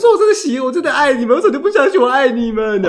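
high, loud pleading, laughing; pleading cry. Retold or imitated. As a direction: beg them to believe you. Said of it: "有的时候就说". (委屈地哀求家人相信)

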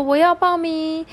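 put-on kids' voices eager to sign up; eager kids. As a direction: pipe up like eager little kids. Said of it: "好多人说".

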